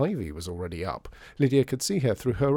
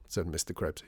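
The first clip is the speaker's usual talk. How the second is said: ordinary delivery, nothing marked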